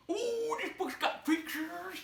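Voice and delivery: a high, bright yelp of surprise at the pictures; delighted surprise. Acted. Finding pictures in the book, suddenly high and delighted. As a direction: gasp with childish delight over the pictures.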